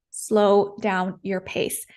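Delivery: slow, evenly spaced words; deliberately slow. Shown as a demonstration. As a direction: give each word room, unhurried. Showing the slower pace advised.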